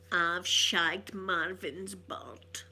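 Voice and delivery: very slow, drawn-out boast; imitated boast. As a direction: stretch out every word like a proud boast. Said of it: "goes like this, he came over and he went".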